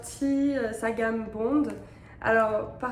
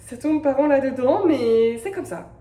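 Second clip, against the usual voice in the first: loud playful defiance; 2 semitones higher, 9 dB louder than usual. Real, not acted. Owning Christmas obsession.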